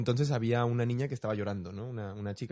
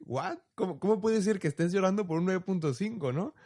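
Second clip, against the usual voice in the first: higher, incredulous retold reaction; disbelief; 5 semitones higher than usual. Teacher reacting to a girl crying over a nine point five, mock disbelief. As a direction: act out the disbelief, half-playful. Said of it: "la reacción fue como de".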